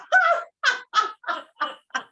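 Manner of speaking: high, long ha-ha laugh; big exercise laugh; spreading laughter. Shown as a demonstration. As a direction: let the laugh pour out. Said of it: "you're just gonna spread it out".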